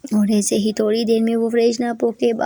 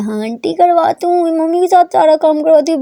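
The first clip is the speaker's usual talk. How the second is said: put-on protesting girl; 6 semitones higher, 6 dB louder than usual. A narrator's reading.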